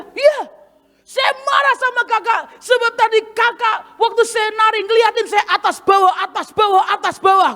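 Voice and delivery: put-on high, insistent complaint in a young woman's voice; aggrieved retold accusation, pitched high; accusing dancer. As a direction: voice the hurt girl scolding her pastor. Dancer accuses preacher of staring.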